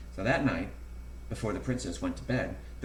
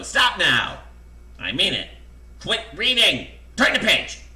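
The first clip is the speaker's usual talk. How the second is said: loud order, building higher; exasperated command; 8 semitones higher, 9 dB louder than usual. A narrator's reading. Narrator ordering the reader to quit reading, loud and exasperated. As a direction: order the reader off, louder and higher each time.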